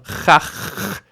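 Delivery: "chach" ending on a long raspy kh; closed syllable. Shown as a demonstration. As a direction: hold the final consonant of "chach" as friction. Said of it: "ends in a consonant".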